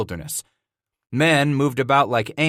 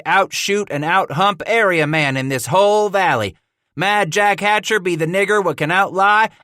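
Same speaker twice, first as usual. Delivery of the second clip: loud, higher brag; boastful trapper; 8 semitones higher, 4 dB louder than usual. A narrator's reading. Jack bragging to Scratch before a wrestle. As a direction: puff up and brag to the whole valley.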